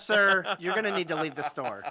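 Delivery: loud firm clerk with a laugh; stern clerk. Pretend. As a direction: order him out of the store. Clerk ordering customer out.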